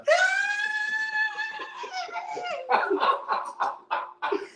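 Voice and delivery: very high, long joyful shriek of laughter; a high, delighted squeal of laughter. Real, not acted. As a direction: scream with delight at the reunion. Joyous reunion laughter.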